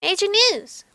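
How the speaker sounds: high excited mock yell. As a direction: shout the news like a giddy friend. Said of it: "Soy yelled out loud".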